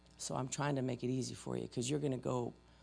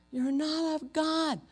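high, slow, drawn-out angry yell; mock screaming accusation; 13 semitones higher, 4 dB louder, slower than usual. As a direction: imitate an outraged accuser, pitched way up. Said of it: "they're screaming and yelling".

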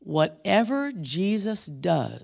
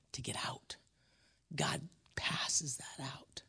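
slow, hushed whisper; 10 dB quieter, slower than usual. Real, not acted. Preacher to congregation, slow whisper. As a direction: whisper it slowly, like a secret.

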